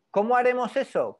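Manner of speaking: punchy teacher's question; emphatic rhetorical question. Acted. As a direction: throw the question out loud and high.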